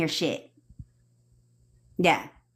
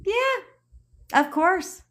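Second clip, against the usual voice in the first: much higher, slow airy yeah; high drawn-out mock yeah; 12 semitones higher, much slower than usual. Answering her own question with a high mock shrug. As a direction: toss off a high, lazy "of course".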